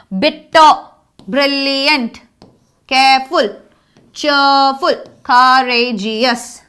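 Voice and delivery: slow word list, each word broken into beats; syllable by syllable. Shown as a demonstration. Teacher listing longer adjectives for the class, syllable by syllable. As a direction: separate the syllables of every word.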